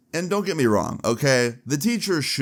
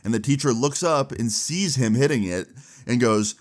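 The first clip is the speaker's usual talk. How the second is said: usual speaking voice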